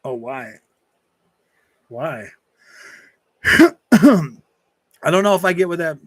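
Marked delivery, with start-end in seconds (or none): none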